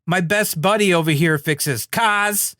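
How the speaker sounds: hammed-up Brooklyn, R dropped from "cars"; imitated Brooklyn accent. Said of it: "He's like".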